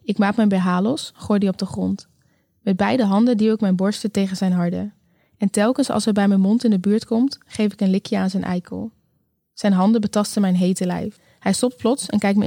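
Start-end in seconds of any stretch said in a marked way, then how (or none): none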